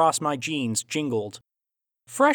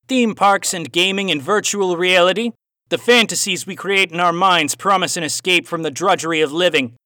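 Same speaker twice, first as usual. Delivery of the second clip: louder, raised Aussie voice with a cowboy twang; Aussie cowboy accent; 5 semitones higher, 6 dB louder than usual. A narrator's reading. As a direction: play the villain Aussie, hint of cowboy twang. Villain's dialogue in story.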